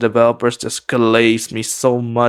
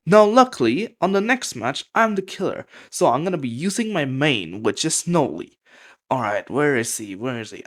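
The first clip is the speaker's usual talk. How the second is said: usual speaking voice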